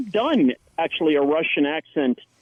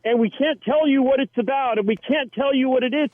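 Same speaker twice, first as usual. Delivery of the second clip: higher, breezy imitation of the producers; lightly voiced vague producer, pitched higher; producers' pitch; 8 semitones higher than usual. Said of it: "to be like".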